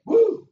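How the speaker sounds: high loud whoop; preacher's whoop. Real, not acted. Preacher whooping to the congregation, high and loud. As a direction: let out a sudden preacher's whoop.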